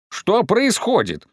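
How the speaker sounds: high, slow exclaimed question; grandmother's outcry. A narrator's reading. Grandmother confronts the rampaging parents, crying out. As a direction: cry it out high, stretching the words. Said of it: "крикнула бабушка Марья".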